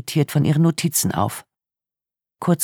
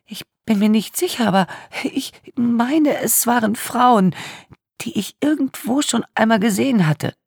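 put-on tearful young woman; 11 semitones higher than usual. A narrator's reading. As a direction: voice a traumatised young woman in tears. Tearful victim speaks.

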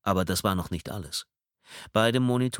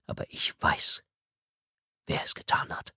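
hushed, unvoiced whisper. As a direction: breathe it like a secret. Confession whispered in interrogation.

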